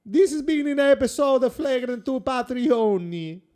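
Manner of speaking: loud, laughing mock Italian accent; boisterous Italian host signing off. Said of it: "speaking in mostly that Italian accent".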